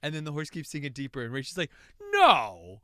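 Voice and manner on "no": loud, sharp cry of dismay; mock horrified no. Rach reacting to the sinking horse, a loud horrified no. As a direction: blurt it out in horror.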